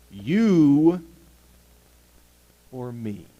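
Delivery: loud, slow, emphatic proclamation; forceful rebuke. Acted. Fish rebukes the man who claims no God, booming rebuke. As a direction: hammer each word out at full preaching volume.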